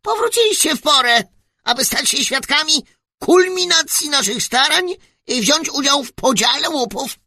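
very high, loud squeak through a megaphone; squeaky little voice. Acted. Tiny ruler greets the travellers through a megaphone, very high squeaky voice. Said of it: "zapiszczał głosik".

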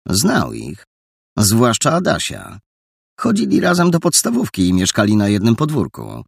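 usual speaking voice

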